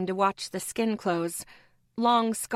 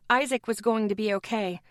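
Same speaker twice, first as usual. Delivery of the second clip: usual speaking voice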